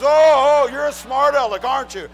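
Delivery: loud, high sarcastic jeer; mocking officer. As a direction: play an officer loudly calling out a wisecrack. Acted officer mocks his joke answer.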